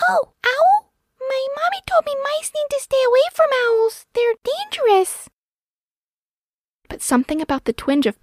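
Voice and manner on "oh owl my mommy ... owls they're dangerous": very high, tiny squeak of a voice; squeaky mouse. A narrator's reading. Little mouse wary of the owl, squeaky. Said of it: "The pink nose squeaked back".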